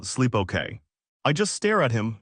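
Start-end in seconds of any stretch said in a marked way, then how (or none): none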